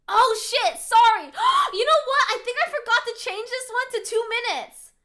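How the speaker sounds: high, loud dismayed realisation; flustered apology. Real, not acted. Streamer realising she set the timer wrong, flustered apology.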